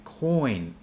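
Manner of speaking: coin with the N sounded; careful model coin, falling; full final N. Shown as a demonstration. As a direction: finish coin clearly on N. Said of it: "cut the N out".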